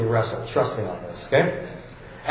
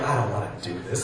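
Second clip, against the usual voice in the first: low, soft, fast reluctant mutter; mock weary referee; 10 semitones lower, 7 dB quieter, much faster than usual. Pastor voicing the poor referee at a kids' match, low and reluctant. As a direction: sigh it out like a ref who wants no part.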